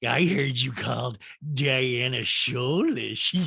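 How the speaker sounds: slow, slurred celebrity caricature; Lisa Rinna. Acted. Reality star confronts castmate over gossip, slurred impression. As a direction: drag it out with a slushy mouth. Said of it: "of Rinna was like".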